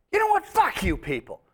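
retold on-stage outburst, pitched high; angry yell at crowd. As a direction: tell the crowd off, furious. Comedian yells at hostile crowd.

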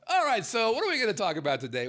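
energetic. Real, not acted. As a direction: punch it up, pumped. Restarting show energetically.

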